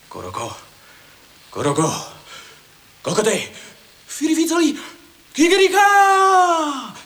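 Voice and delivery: from plain to very high loud cry; building declamation. Acted. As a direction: climb to a crowing shout. Poet builds to crowing cry.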